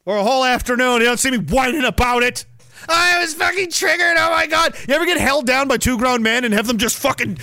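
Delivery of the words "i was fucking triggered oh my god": hammed-up whiny outrage; mock outrage. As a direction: play it as hammed-up offence.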